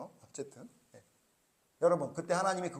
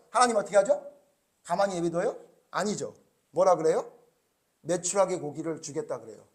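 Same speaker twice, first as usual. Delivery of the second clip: normal talking voice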